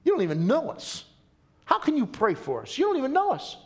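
skeptical retort. Retold or imitated. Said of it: "someone would say".

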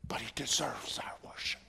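sudden hushed whisper. Acted. Preacher hushing the church on why God deserves worship, whispered. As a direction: drop the voice right down for weight.

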